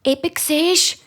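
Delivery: hohe freche Kinderstimme; kleines Mädchen. A narrator's reading. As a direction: gib hoch zurück wie ein kleines Kind. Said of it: "Nachher sagt die Kleine". (hochi Chinderstimm)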